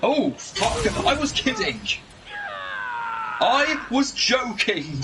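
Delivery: high, hurried take-back; flustered backpedalling. Real, not acted. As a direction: quickly insist it was a joke. Backpedalling after dark joke.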